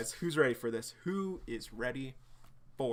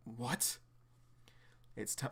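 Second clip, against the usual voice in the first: plain reading voice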